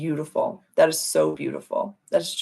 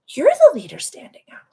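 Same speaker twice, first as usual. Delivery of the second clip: higher, louder emphatic whisper; loud stage whisper; 12 semitones higher, 8 dB louder than usual. Real, not acted. Host insists to viewers they are the leaders, in a forceful stage whisper.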